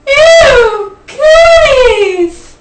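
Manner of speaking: very high, loud squeal; long drawn-out playground yell; mock disgusted shout. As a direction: wail it in hammed-up revulsion. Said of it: "But really what he meant was".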